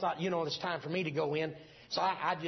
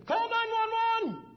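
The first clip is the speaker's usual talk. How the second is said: much higher, louder panicked mock scream; imitated scream; 6 dB louder, much slower than usual. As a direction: play the frantic mother's cry. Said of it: "my mom didn't scream".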